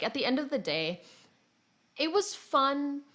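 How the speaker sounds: ordinary talk, nothing marked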